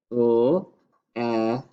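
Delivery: "L-et" as l, pause, e and a separate t; sounded out. Shown as a demonstration. Teacher repeating the second syllable, "L-et" slowly separated. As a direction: slowly pull "L-et" apart sound by sound. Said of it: "second syllable is let".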